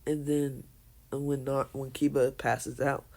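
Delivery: usual speaking voice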